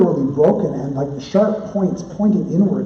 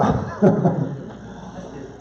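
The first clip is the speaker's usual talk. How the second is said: breathy unvoiced chuckle; a short soft laugh after the aside; 4 semitones higher, 4 dB quieter than usual. Real, not acted. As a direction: laugh quietly at your own joke. Laughs at own joke.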